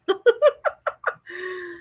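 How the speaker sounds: high-pitched real giggle; laughing. Real, not acted. Amused by the word dispute.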